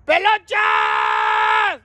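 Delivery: long call rising at the end; drawn-out rising shout. Real, not acted. Calling a friend from the street, long rising shout.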